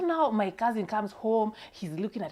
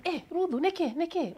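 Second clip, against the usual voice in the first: put-on high-pitched old granny calling out; a Kikuyu grandma calling her granddaughter; grandma's voice; 11 semitones higher than usual. Grandma voiced in retold story.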